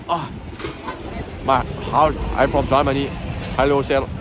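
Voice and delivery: halting attempt at a German accent; badly faked German visitor. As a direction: fumble your way into a German accent. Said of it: "I can't do a German accent".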